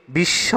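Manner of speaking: বিশ্ব said as "bish-sho"; doubled consonant. Shown as a demonstration. Teacher gives an example of doubling, demonstrating "bish-sho" for বিশ্ব. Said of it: "বর্ণটি দ্বিত্ব হবে।".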